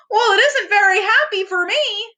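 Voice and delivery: high, small tearful Piglet voice; a timid piglet close to tears; gulping Piglet. A narrator's reading. Narrator reading Piglet to a child, high and tearful. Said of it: "Piglet said with a gulp".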